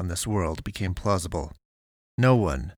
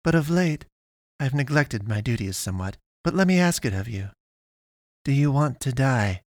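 put-on higher, formal girl's voice; stilted otherworldly girl, gently pitched up; polite alien pastoress; 5 semitones higher than usual. A narrator's reading. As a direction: pose it politely in a raised character voice. Pastoress continues her confession.

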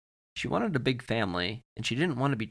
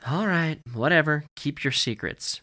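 mock-resigned dad; 5 semitones higher, 3 dB louder than usual. A narrator's reading. Dad gives up on daughter's secret.

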